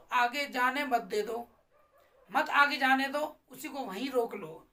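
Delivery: normal talking voice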